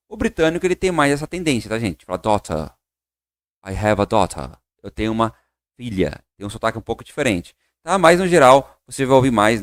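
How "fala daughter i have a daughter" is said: daughter with a crisp strong T; British daughter, T hit hard. Shown as a demonstration. Teacher shows students the British daughter. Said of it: "O som do T forte".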